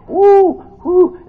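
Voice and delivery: two short high woos; hammed-up loud hoots of pain; mock pain whoops. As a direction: yelp twice as the cramp hits. Retelling the cramp.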